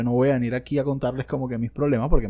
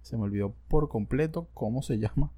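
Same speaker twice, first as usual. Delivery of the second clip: ordinary delivery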